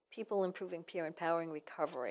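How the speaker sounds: quick, run-together name; said fast. Real, not acted. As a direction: rattle off the organization's full name. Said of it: "easier now to say it fast".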